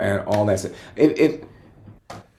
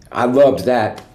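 ordinary talk, nothing marked

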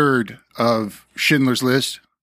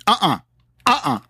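firm refusal; 6 semitones higher than usual. Retold or imitated. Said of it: "We said".

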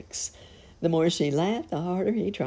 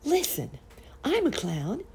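high, eager announcement; sudden idea; 11 semitones higher than usual. A narrator's reading. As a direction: burst out with it, pitched way up. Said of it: "all of a sudden, he said".

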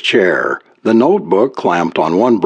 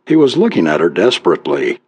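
ordinary delivery, nothing marked

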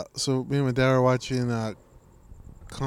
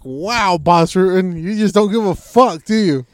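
higher, much louder awe; amazed outburst; 6 semitones higher, 8 dB louder, slower than usual. Retold or imitated. Speaker reacting to the fighter's advice, loud amazement. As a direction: shout your disbelief at him. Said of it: "And I was like".

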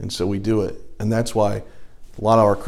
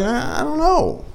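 mock hesitant; 12 semitones higher, 4 dB quieter than usual. Hesitating over tempting sin.

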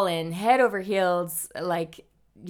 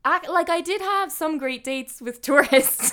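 laughing; 9 semitones higher, 4 dB louder than usual. Real, not acted. Laughing while admitting tourist dates.

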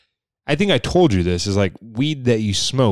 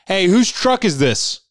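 higher, slow bossy call across the lobby; mock security guard; 9 semitones higher, 3 dB louder, slower than usual. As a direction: play the pushy guard calling out a car owner. Imitating security guard calling out.

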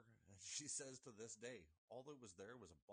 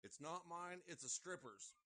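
mild mock holler; 6 semitones higher, 4 dB louder than usual. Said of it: "I hollered out the window".

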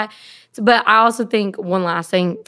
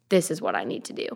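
lower, softer inner realization; still quiet; 4 semitones lower, 6 dB quieter than usual. Retold or imitated. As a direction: say it softly and low, as if in a quiet moment. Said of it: "it has been in still quiet".

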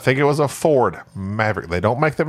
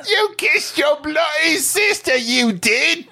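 put-on Aussie accent; 14 semitones higher, slower than usual. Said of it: "drinking Foster".

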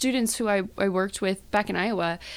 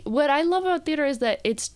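normal talking voice

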